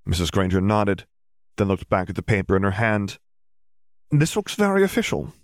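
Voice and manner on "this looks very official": lightly voiced mother. Mrs Granger voiced in audiobook.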